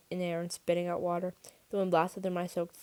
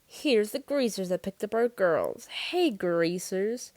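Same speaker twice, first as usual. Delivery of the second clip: drawn-out, louder jeer; taunting; 2 semitones higher, 5 dB louder, much slower than usual. A narrator's reading. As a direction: call them out slowly, mockingly. Rival taunting the greasers.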